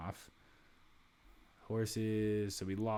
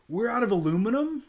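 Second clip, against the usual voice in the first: much higher and louder, sudden alarm; shocked realization; 12 semitones higher, 9 dB louder than usual. Real, not acted. Player discovers no aluminum left, stunned. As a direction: blurt it out in disbelief.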